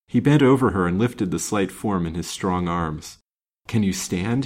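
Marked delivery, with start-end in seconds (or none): none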